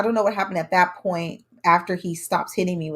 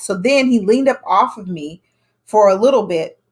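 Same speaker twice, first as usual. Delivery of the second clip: ordinary talk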